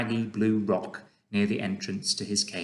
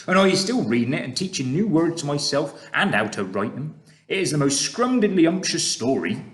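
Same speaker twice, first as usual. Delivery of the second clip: louder, friendly giant with odd grammar; proud giant sharing his treasure; booming, kindly giant voice; BFG voice; 6 semitones higher, 5 dB louder than usual. Acted.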